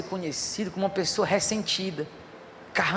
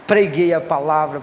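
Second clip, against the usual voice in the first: proclaimed loud; 4 semitones lower, 8 dB louder than usual. Acted. Preacher recalls father's funeral sermon.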